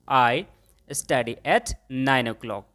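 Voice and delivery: very slow, each word separate; slow model sentence. Shown as a demonstration. Teacher models the answer for students, very slowly. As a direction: lay it out slowly for the class. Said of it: "मैंने बोला कि मैं नौ बजे पढ़ता हूँ".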